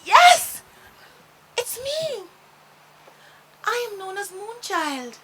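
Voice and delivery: high, bright and thrilled; bursting with joy at a new friend; excited. A narrator's reading. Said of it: "She was so excited".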